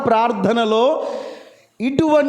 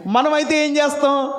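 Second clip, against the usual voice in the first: normal talking voice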